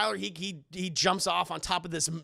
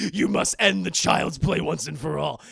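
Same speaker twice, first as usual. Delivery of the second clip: booming, hammed-up heroic declaration, louder; epic movie line; 3 semitones lower, 7 dB louder than usual. Narrator wishing De Silva said this, booming epic hero line. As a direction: deliver it like a trailer voice. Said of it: "They don't say that, but I wish they did".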